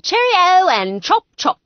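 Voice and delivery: a loud, high mock British accent; shouted British accent. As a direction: belt out a fake posh British line. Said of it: "For the love of God, stop it".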